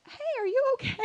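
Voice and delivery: high-voiced, solicitous question; concerned boyfriend. Boyfriend's unexpected concern, acted.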